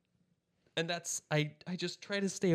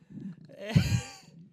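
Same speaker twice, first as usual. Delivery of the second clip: high-pitched burst of laughter; high squealing laugh. Real, not acted. Laughing at parents missing film.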